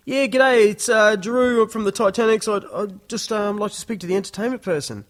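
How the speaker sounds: high, loud, eager caller voice, trailing off; nervous band caller. Retold or imitated. Band member ringing the pub for a gig, high and eager.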